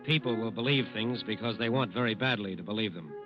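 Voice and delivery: ordinary talk, nothing marked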